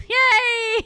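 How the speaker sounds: loud, high, drawn-out cheer; jubilant cheer. Real, not acted. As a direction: whoop in victory. Celebrating the boss kill.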